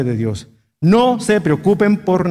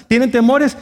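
usual speaking voice

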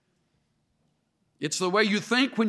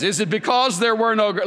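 raised, louder mock complaint; sarcastic; 4 semitones higher, 6 dB louder than usual. Israelites complain to Moses, loud sarcasm. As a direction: throw it at him with sarcasm. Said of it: "listen to the sarcasm".

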